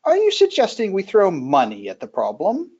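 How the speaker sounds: lightly voiced king, dry disbelief; incredulous king. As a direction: ask it as if the idea is absurd. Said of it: "the king just looks and goes".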